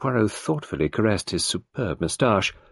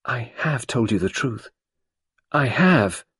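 passionate; 5 semitones higher than usual. Acted. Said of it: "turning round passionately".

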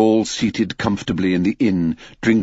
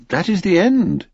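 high birdlike finishing voice; linnet voice; 11 semitones higher than usual. Acted. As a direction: chirp it high like a small bird. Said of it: "said the linnet".

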